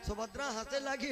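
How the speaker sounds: ordinary talk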